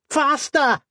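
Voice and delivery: very high, frantic yell; scream. A narrator's reading. Lizzie chased by mechanical hounds, high urgent scream. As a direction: scream it to hurry them on. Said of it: "Lizzie screamed".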